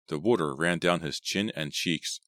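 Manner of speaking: ordinary delivery, nothing marked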